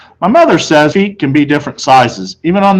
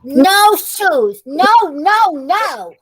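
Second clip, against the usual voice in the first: mild mock scream; much slower than usual. A narrator's reading. Fudge screaming at the salesman, mild. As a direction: squeal the refusal like a toddler. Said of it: "He screamed".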